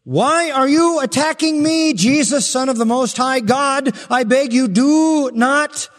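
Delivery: high, loud pleading that slows at the end; shrill desperate demon plea, drawn out on the last words; begging demon voice. Acted. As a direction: beg like a terrified demon. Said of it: "That's the demon talking".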